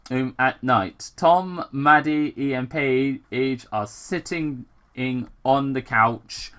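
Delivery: ordinary delivery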